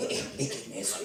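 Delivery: whispered question; hushed request. Retold or imitated. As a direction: ask it under your breath. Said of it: "hija, me dijo". (pregunta susurrada)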